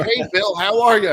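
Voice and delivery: high, bright mock greeting; cheery chatter greeting. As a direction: call out like a chatty viewer. Voicing chatters greeting each other.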